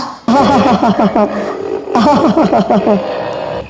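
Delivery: a deep, amused laugh at a silly idea; low mocking laugh. Father laughing off the talking trunk, low chuckle.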